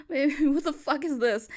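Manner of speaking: usual speaking voice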